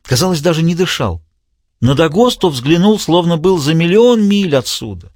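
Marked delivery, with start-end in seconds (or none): none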